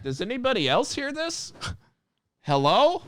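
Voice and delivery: very high, bewildered calling out; confused Travolta meme. Retold or imitated. Worker acting lost like the Travolta meme. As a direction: look around lost, asking if anyone hears. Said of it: "like a John Travolta Pulp Fiction".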